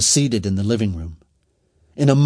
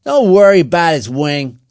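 loud, higher brush-off; bossy and raised; dismissive; 5 semitones higher, 9 dB louder than usual. A narrator's reading. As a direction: wave off the worry forcefully. Leader dismisses the protest.

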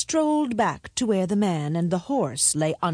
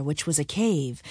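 plain reading voice

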